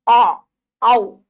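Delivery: loud, wide-open औ sound; औ voiced loudly with a dropped jaw; open-mouthed vowel. Shown as a demonstration. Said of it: "बड़ा मुंह खुल जाएगा".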